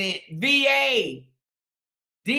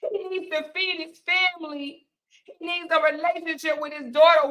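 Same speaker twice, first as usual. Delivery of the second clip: mock tearful begging; 4 semitones higher than usual. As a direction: imitate supporters wailing and begging. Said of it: "act like they wanna cry and beg".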